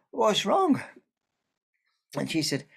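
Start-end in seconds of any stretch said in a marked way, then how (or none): 0.1-0.8 high, loud worried call; concerned child. A narrator's reading. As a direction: call out anxiously. Child calls to baker.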